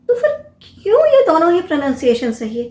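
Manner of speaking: usual speaking voice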